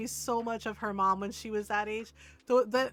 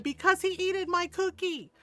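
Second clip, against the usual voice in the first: put-on higher, indignant little-kid voice; small child's protest; 8 semitones higher, 4 dB louder than usual. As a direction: protest like an aggrieved four-year-old. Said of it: "she said".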